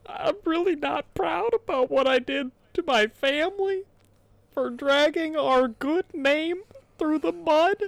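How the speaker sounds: hammed-up tearful whine; mock crying voice. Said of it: "Smith said through tears".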